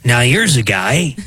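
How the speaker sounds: gravelly impression of a TV commentator; half-serious gravelly character voice. Shown as a demonstration. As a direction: growl the catchphrase through a gravelly throat. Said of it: "just me in a gravelly voice".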